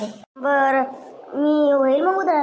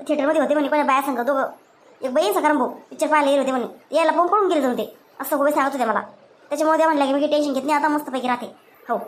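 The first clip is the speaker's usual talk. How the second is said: usual speaking voice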